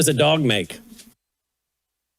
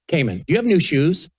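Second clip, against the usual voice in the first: ordinary delivery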